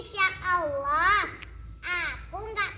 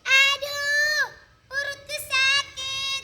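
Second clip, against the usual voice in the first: clutch the stomach and whimper in pain; crying in pain; 6 semitones higher, slower than usual. Acted. Lala, crouching with a stomach ache, cries out in pain. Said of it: "memegangi perutnya sambil menangis".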